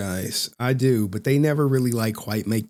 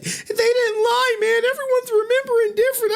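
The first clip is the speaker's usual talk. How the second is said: mock whining. Streamer mimicking the viewers, high hammed-up whining. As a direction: wail it like a hammed-up crybaby. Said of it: "So for all y'all like".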